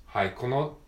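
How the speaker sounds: usual speaking voice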